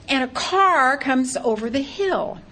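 usual speaking voice

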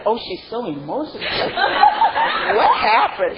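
quoting onlookers through laughter, rising high; laughing mock gossip. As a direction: imitate the onlookers while still laughing. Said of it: "And people say".

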